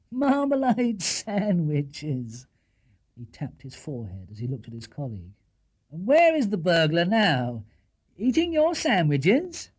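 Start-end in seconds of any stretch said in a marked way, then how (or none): 0.1-2.5 drawn-out mock wonder; humouring. Policeman humouring the bear, drawn out. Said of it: "trying to humour Paddington". 5.9-9.7 mocking, higher, slow; humouring. Policeman teasing the bear about sandwiches. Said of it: "trying to humour Paddington".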